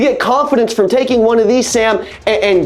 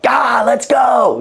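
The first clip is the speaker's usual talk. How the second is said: slow hyped shout, big and drawn out; fired-up shout; 2 semitones higher, much slower than usual. Retold or imitated. On Zoom with his sales team, hyped-up shout. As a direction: let it rip like you're pumping up the room. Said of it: "I get so fired up".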